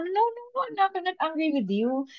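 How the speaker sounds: retelling her own words to her son, high and close to tears; a mother near tears reassuring her crying son; tearful. Retold or imitated. Mother near tears telling her crying son she isn't angry, high and tearful. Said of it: "sabi niya, naiiyak ako".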